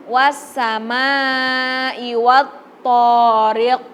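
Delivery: very slow wassamai wattariq with the q bouncing; qalqalah bounce. Shown as a demonstration. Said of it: "jadi harus memantul". (wattariq dibaca memantul)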